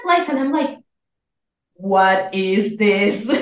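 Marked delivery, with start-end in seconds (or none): 1.7-3.3 slow, stunned what-is-this; bewildered. Retold or imitated. Leaving the office, reliving the moment, stunned and slow. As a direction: drag it out in disbelief.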